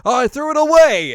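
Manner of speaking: loud, high, overdone line read; hammed-up perky cartoon delivery; over-enthusiastic character voice. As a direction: blast it out with too much enthusiasm. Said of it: "like, the character".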